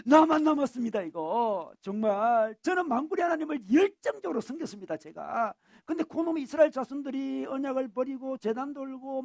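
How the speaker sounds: loud, high-pitched aggrieved outpouring; pent-up resentment bursting out. Acted. Elijah complains to God that only he remains, bursting with resentment. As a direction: pour out the bitterness as a heated complaint. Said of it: "막 울분이 토해지는 거 느끼죠".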